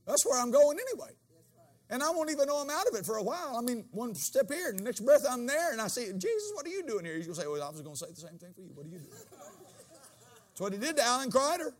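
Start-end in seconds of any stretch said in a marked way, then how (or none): 0.1-7.2 louder, higher preacher's call; fiery pulpit preaching; full-force preaching. Acted. 7.5-9.1 gentle reply. Retold or imitated. Jesus answers the preacher.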